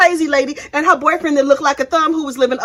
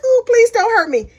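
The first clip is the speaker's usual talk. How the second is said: mock scared; 10 semitones higher than usual. Mocking a scared witness pleading with Lori, high mock fear. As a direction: play a timid witness begging not to be hurt. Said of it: "She was just like".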